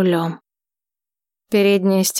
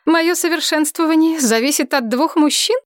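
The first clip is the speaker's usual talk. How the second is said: laughing; 8 semitones higher than usual. Acted. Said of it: "вдруг рассмеялась".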